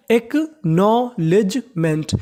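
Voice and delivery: Acknowledgement very slowly, split as एक - नॉ - लेज - मेंट; syllable by syllable. Shown as a demonstration. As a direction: separate every syllable of acknowledgement. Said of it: "एक बार फिर से पढ़ लेते हैं". (एक्नॉलेजमेंट का हर हिस्सा अलग, धीरे)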